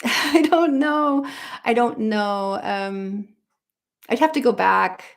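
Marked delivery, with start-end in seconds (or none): 0.0-1.3 laughing it out, higher. Real, not acted. Stumped by the interviewer's question, laughing. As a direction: giggle through the words.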